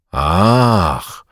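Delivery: long, lingering sigh of a word; drawn out. A narrator's reading. Wszebor answers Bugaj's complaint, drawling it out. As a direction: stretch it out knowingly. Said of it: "przeciągnął Wszebor".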